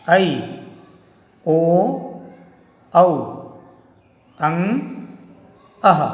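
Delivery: each Hindi vowel अ, आ, ई, उ, ए, ओ said alone and clearly, with a pause after each; vowel drill. Shown as a demonstration. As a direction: recite the vowels slowly, one at a time, for pupils to repeat. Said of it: "दोबारा आप बोलेंगे, बोलने का अभ्यास करेंगे".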